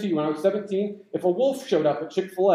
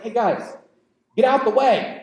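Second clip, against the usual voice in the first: raised, louder mock order; Persian messenger; 4 semitones higher, 5 dB louder than usual. Persian messenger ordering the Spartans aside, raised mock command. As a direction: call out a messenger's demand. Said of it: "he sends this message".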